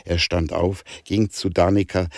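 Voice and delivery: plain reading voice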